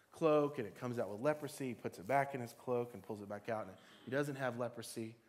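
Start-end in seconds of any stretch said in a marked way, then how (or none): none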